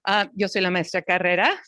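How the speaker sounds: ordinary delivery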